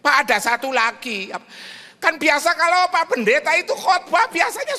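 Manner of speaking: put-on high, loud old lady; pleading widow voice; old woman caller.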